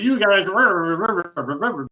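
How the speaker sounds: imitating a scolding that blurs into rah-rah noise; blah-blah imitation of a tirade; mock rant. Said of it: "and here's Durbin. He goes".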